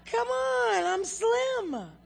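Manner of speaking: high, loud, drawn-out brag; mock boasting. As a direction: shout it as a hammed-up boast. Acting out a proud boast.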